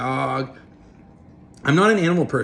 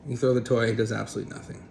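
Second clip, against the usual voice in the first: usual speaking voice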